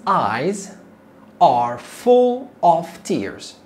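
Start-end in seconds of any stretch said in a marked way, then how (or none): none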